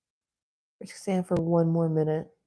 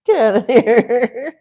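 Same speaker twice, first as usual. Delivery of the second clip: loud, slow telling-off; scolding the dog; 5 semitones higher, 10 dB louder, slower than usual. Real, not acted. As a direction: shoo the dog off firmly, drawn out.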